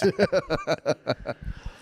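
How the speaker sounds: high burst of giggling; helpless cackle at own bit; laughing. Real, not acted. Podcast host cracks up at his own joke, laughing. Reacting to beat-your-ass joke.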